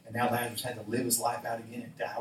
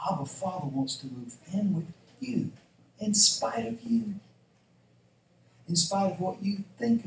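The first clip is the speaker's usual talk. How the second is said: raised, emphatic sermon delivery; impassioned preaching; 8 semitones higher, 4 dB louder than usual. Real, not acted. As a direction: preach it high and forceful, phrase by phrase. Preaches with rising force.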